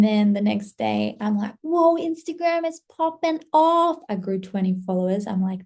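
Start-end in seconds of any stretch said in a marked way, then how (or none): 1.6-4.0 high-pitched excited exclamation; excited amazement. Retold or imitated. Creator retelling her thrill at new followers, excited.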